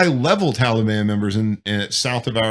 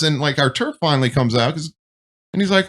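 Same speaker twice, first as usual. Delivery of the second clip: ordinary talk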